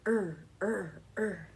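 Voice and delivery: a short buzzing er, er, er; growly er sounds. Shown as a demonstration. Teacher showing kids the rabbit sound, growly er. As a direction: say "Er" like a rabbit's rumble, in bounces. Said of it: "and you go".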